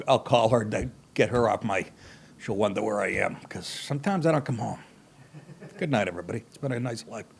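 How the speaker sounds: a husband expecting trouble at home, fading off; fading mock doom.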